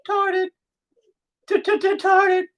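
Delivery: very high squeaky cartoon voice; teasing falsetto stutter; funny voice. Shown as a demonstration. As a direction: squeak it out to make it sound funny. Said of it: "so it's okay if I make it sound funny".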